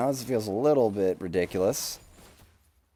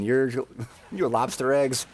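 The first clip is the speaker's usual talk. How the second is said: normal talking voice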